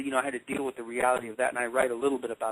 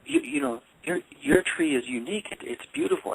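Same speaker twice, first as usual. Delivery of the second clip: soothing mom; 11 semitones higher than usual. Retold or imitated. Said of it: "And she said".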